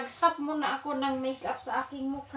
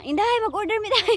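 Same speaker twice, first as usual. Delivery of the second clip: mock customer; 8 semitones higher, 6 dB louder than usual.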